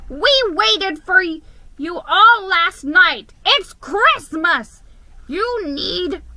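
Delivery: high, loud, slow scolding; scolding grandfather. A narrator's reading. Grandfather scolds his grandson for missing Christmas, loudly. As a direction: lay the guilt on him loudly. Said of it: "he thundered".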